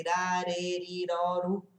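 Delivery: ra, re, ri, ro, ru with one tongue flick each; Portuguese tapped R. Shown as a demonstration. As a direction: chant the syllables ra, re, ri, ro, ru with tapped R. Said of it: "igual o nosso". (ra, re, ri, ro, ru com R brasileiro curto)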